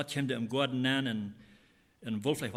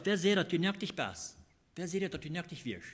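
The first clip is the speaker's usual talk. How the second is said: raised, higher-pitched questioning of a wrongdoer; confronting question; 6 semitones higher than usual. Retold or imitated. God questions Adam about his nakedness, confronting.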